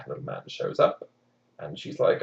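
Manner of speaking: usual speaking voice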